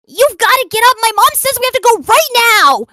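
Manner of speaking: high, loud, whiny mock girl; mocking girl's demand. Mocking the friend who demanded he get up, high and loud. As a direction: play her up as a bossy, high-pitched girl.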